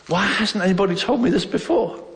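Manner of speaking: high-pitched, amazed question; so excited. Retold or imitated. Teenager hearing the good news from his sister, excited. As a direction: burst out with eager amazement. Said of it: "I mean, I was so excited".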